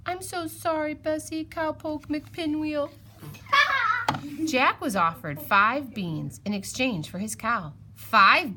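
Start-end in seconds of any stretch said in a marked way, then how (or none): 3.2-4.4 high laugh. Real, not acted. Laughs at the silly name.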